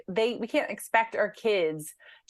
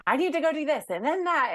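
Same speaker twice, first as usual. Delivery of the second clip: higher, rushed self-talk; hurried inner voice; 8 semitones higher than usual. Retold or imitated. Mom voicing her own rushed thoughts in the shower, hurried. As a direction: rattle it off like a busy mom's racing mind.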